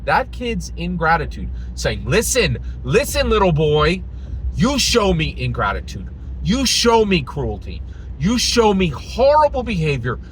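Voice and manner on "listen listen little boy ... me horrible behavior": loud, high, emphatic scolding; hammed-up parent laying down the law; mock stern lecture. Mock speech of indulgent parent.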